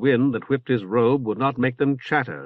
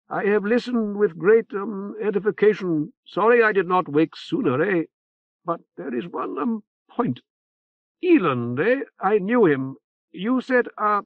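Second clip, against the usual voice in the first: put-on high nasal old priest; 8 semitones higher than usual. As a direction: pinch it through the nose, hesitant. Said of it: "Remora's nasal voice floated out".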